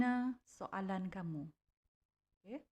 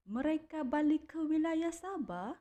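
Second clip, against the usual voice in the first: high, louder rising question on Sabah; rising question tune; 8 semitones higher, 5 dB louder than usual. Shown as a demonstration. Teacher models the second example question, high rising tune. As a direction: lift the voice high at the end of the question. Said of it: "menggunakan intonasi nada suara yang agak tinggi".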